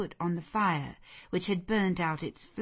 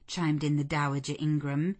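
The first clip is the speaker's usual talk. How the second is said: ordinary delivery, nothing marked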